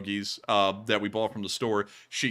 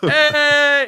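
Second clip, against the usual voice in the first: high, loud, drawn-out hey; excited cheer; 13 semitones higher, 12 dB louder than usual. Real, not acted. Streamer greeting a donation, excited shout. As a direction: cheer the donor at full volume.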